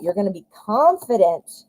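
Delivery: ordinary talk, nothing marked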